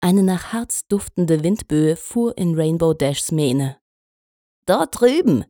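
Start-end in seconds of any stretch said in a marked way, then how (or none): 4.7-5.5 high, loud call across the wind. A narrator's reading. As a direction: call out and point the way. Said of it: "rief sie Celano zu".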